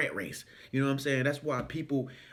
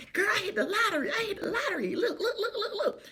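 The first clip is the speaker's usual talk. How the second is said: high, giddy, laughing boast; excited lottery winner. Retold or imitated. Stranger showing the ticket, high and giddy. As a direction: squeal it like you just won big. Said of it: "And they be like".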